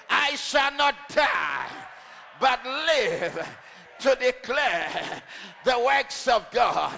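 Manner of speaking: loud, driving, shouted declarations; preacher rousing the congregation, shouting; preaching at full force. Acted. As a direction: hammer each claim home like a warrior.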